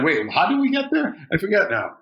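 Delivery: high, puzzled self-quote; confused surprise. Retold or imitated. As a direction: act suddenly lost, laughing. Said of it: "I was like".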